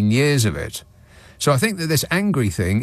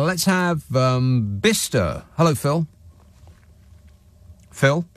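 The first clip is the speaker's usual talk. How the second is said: normal talking voice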